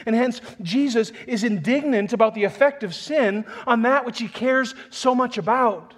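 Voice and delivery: fervent pulpit preaching; full-force preaching. Acted. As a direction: preach it out with rising fervor.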